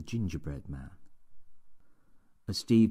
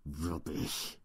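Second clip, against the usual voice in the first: hissed; 6 dB quieter than usual. Acted. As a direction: hiss it right into his face. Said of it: "he hissed".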